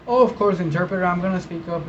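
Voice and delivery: mock eager client. Mocking a client who promises the interpreter to speak up, high and quick.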